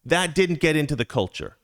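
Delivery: ordinary talk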